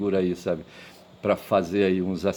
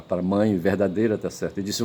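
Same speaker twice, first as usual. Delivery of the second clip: usual speaking voice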